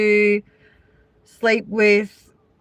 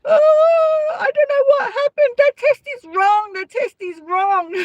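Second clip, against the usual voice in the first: much higher, wailing, fast hysterical protest; distraught denial. Retold or imitated. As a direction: imitate a sobbing guest refusing the result. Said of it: "these chicks would be like".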